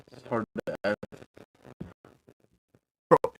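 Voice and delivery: usual speaking voice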